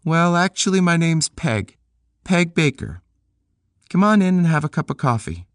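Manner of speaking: put-on high young woman's voice, friendly invite; Peg's voice. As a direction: voice Peg inviting him in. Narrator voices Peg.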